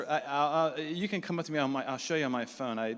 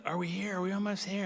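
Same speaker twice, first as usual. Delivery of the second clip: higher imitated whine; pleading question; 5 semitones higher than usual. Tired trekkers asking the guide, high and pleading. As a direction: ask like kids on a long trip. Runners anxiously ask if arrived.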